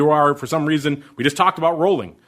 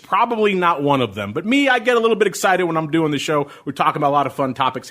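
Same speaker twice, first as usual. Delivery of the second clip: usual speaking voice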